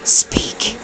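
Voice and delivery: softly whispered one-word command. Real, not acted. Whispering to the dog holding a hot dog. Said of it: "I'm whispering to her".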